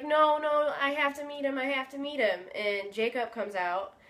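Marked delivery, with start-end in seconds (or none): 0.0-2.5 imitating a crying fan in a high voice; mock-desperate, higher-pitched insistence; retold tearful pleading. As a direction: plead in a lightly mimicked high whine. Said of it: "she's still crying".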